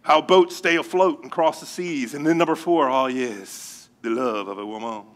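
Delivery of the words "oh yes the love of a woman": low, soft mock French accent. Preacher returning to the fourth mystery, mock French accent. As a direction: savour it softly, Frenchman-style accent. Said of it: "say it with a French accent".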